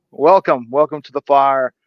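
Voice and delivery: normal talking voice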